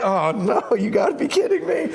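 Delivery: high, amused you-gotta-be-kidding-me; laughing disbelief. Retold or imitated. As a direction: laugh through the shock of the coincidence. Driver recognizes the famous student.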